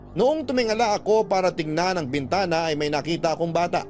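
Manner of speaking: ordinary talk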